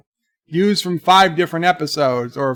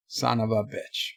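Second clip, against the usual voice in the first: soft and low; quiet, low variant; 5 semitones lower, 9 dB quieter than usual. Shown as a demonstration. Streamer demonstrating ways to say it, quiet and low. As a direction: say it low, under the voice. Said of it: "how many different ways can you say".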